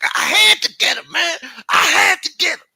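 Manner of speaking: very high, loud falsetto outburst; squealed protest. Retold or imitated. Boogaloo defending the shooting to his accomplices, shrieked falsetto. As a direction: yell your excuse in a cartoonish squeal.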